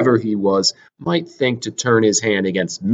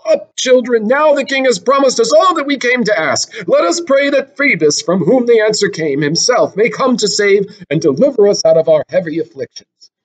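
put-on raised, higher voice of an old priest addressing the children; the priest's voice; 9 semitones higher than usual. Priest tells the suppliants the king will help, in a raised, higher voice.

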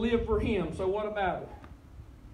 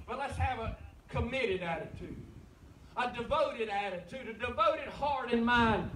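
ordinary talk

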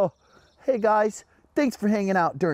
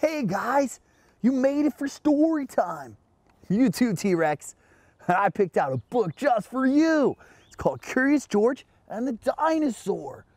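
put-on high, lilting voice for small children; children's story-time greeting; 8 semitones higher than usual. Host greeting children for story time, high and lilting. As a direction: welcome little listeners to story time, sing-song.

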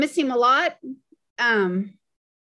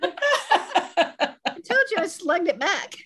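laughing; 7 semitones higher, much slower than usual. Real, not acted. Laughing at own slip.

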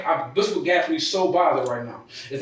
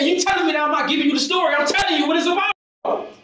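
high and loud, voice straining upward; furious yelling; 13 semitones higher, 5 dB louder than usual. Real, not acted. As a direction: yell it in outrage at the accusation. Suspect yelling at detective.

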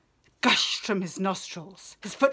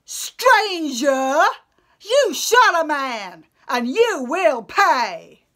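angry rebuke; 3 semitones higher, 8 dB louder than usual. Acted. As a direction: yell the threat at the stranger in rage. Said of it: "They angrily rebuked Odysseus".

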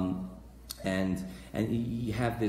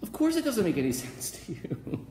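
amused exasperation; 6 semitones higher than usual. Acted. Preacher repeating the retort to the doubter, amused exasperation. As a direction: retort breaking into a laugh.

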